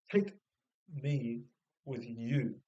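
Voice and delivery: "Take", "me", "with you" set apart by pauses; word-by-word emphasis. Shown as a demonstration. Speaker correcting "with me" to "with you", word-by-word emphasis. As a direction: spell out "Take me with you" deliberately, pausing between words.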